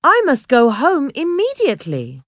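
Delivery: indignant storybook exclamation; exclaiming in protest. A narrator's reading. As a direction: insist on going home at once. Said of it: "Harvey exclaimed".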